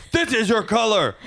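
very high, strained mock scream; over-the-top parental shriek, held high; hammed-up scream. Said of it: "I screamed".

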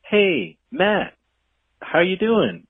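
bright, high hello to a half-stranger; overly chummy hi; mock friendly greeting. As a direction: greet them like an old friend. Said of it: "I'll be like".

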